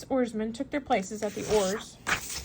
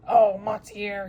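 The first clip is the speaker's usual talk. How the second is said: louder, slow, trembling plea; 8 dB louder, slower than usual. A narrator's reading. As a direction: voice shaking with eagerness. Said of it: "he said, trembling voice".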